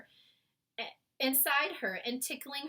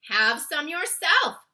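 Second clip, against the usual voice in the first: loud, slow, higher offer; giant cry; 6 semitones higher, 12 dB louder, slower than usual. A narrator's reading. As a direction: call out the offer. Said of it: "cried the B F G".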